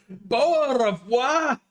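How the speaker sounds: hammed-up British bafflement, pitched high; British accent. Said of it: "Can you do the British".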